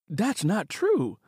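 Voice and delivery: protesting. Acted. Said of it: "Simi protested".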